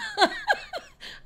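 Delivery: sudden high giggle; high laugh. Real, not acted. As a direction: burst into a high embarrassed giggle. Laughs at being called rambling.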